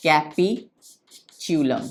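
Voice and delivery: capitulum very slow, split in two parts; syllable-by-syllable dictation. Shown as a demonstration. Teacher dictates capitulum to students, slowly in separate parts.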